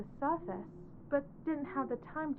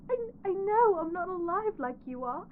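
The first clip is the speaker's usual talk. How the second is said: higher and louder, voice breaking; crying plea; 4 semitones higher, 4 dB louder than usual. Acted. Bride pleads with hidden groom, crying. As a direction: sob it out, desperate to be accepted.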